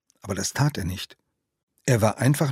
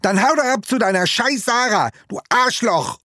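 much higher, louder furious scream; screaming at him; 12 semitones higher, 4 dB louder than usual. Acted. Hurt boy screaming insults at his friend, furious. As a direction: scream it so loud the ducks fly off. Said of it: "brüllte Oskar".

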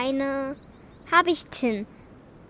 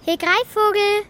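higher, louder call; 6 semitones higher, 6 dB louder than usual. Acted. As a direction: call up to the tree. Calling up to a bird.

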